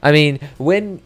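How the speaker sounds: ordinary talk, nothing marked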